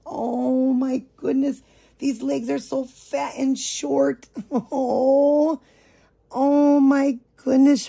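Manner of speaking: high and loud, with a laugh; giddy, laughing delight; excited gush. Real, not acted. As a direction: gush over the chubby legs. Admiring the doll's legs, laughing.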